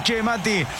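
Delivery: ordinary delivery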